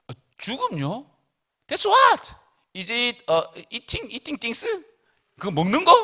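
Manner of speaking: baffled, as if the word is new; confused Adam. Acted. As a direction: naive Adam guessing death is food. Adam doesn't know what death is.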